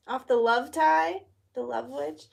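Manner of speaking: ordinary talk, nothing marked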